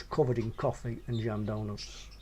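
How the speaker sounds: ordinary delivery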